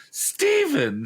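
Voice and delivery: high cry of the name. Acted. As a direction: call out his name. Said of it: "she cried".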